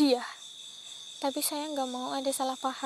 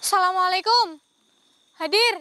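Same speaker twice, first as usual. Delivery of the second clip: loud, higher, forceful command; loud summons; 4 semitones higher, 10 dB louder than usual. Real, not acted. Summoning the crying spirit, loud. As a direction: call her to appear.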